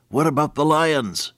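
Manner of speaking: put-on high, worried little boy asking about lions; lightly voiced frightened boy; scared little boy. Boy's quote repeated with emphasis.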